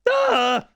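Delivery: sudden high loud yelp; a sharp startled shout; startled cry. Real, not acted. Commentator yelping as his Coke drops. Dropped his drink in the box.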